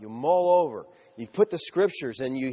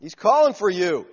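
loud, high-pitched rallying call; hammed-up crowd suddenly cheering him on; eager encouragement; 4 semitones higher, 7 dB louder than usual. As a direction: urge someone up, acted excitement. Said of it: "they're trying to encourage".

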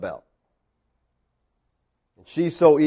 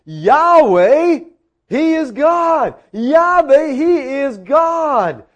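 high, slow, loud proclamation; crowd's awed cry; 11 semitones higher, 5 dB louder, much slower than usual. Acted. All the people fallen on their faces, awed proclaiming cry. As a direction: cry it out slowly, voice lifted high. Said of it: "fell on their faces and they said".

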